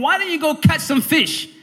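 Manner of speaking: high, mildly angry scolding question; agitated, a bit angry. Retold or imitated. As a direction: act out an irritated businessman challenging someone. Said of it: "a little bit agitated, a bit angry".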